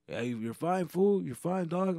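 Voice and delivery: high, casual street-guy reassurance; playing the stranger calming him down.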